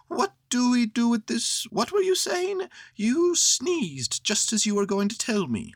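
high-pitched bear interrupting; Pooh, puzzled. Acted.

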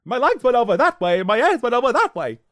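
loud, high mock panic; film character impression. Scattered Scarecrow reporting damage, loud high mock voice. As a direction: yelp it in a hammed-up high voice. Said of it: "What was that thing he did?".

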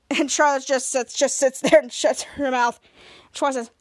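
ordinary delivery, nothing marked

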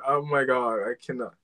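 normal talking voice

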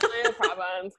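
high, squealed through laughter; real laughing. Real, not acted. Echoing friend's joke, laughing high. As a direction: crack up while saying it.

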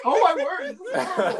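high, loud exclamation with laughter; exasperated outcry. Real, not acted. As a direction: despair loudly at the misbehaving page. Despairing at loading ads mid-read.

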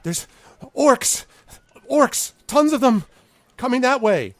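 high-pitched, loud frantic shout; panicked warning. Acted. Villager warns of orcs coming, panicked shouting. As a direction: cry out the alarm to everyone.

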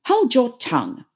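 high, loud rebuke; brusque creature's command; brusque Gryphon. A narrator's reading. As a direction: silence them sharply. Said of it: "added the Gryphon".

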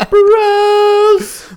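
high, loud drawn-out call; hype shout. Acted. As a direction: belt out the name. Show name sung out.

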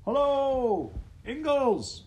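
loud, high hailing call; husky shout. A narrator's reading. Visitor hails the house from outside, husky shout. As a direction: holler a greeting from out in the storm. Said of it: "a husky voice shouted".

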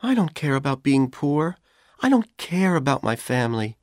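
Dorothy pushing back through her worry; Dorothy, upset but insistent. A narrator's reading. Narrator voices Dorothy.

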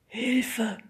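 frightened breathy whisper. A narrator's reading. Timo panics in front of the teachers, whispering. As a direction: whisper it in terror. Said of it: "flüsterte Timo".

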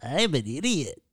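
swooping, performed self-mocking drop; different silly voice. Shown as a demonstration. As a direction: another goofy voice for the catchphrase. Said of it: "Can you say it in a different voice?".

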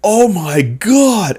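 high, slow amazed shout; amazed cheering. Retold or imitated. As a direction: cheer in disbelief like the watching boys. Boys cheering him flying.

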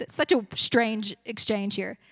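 ordinary delivery

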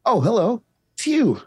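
put-on bright, high children's TV host voice; cheery kids' show host. [name] greeting the viewers in a cheery host voice. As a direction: beam at the kids at home.

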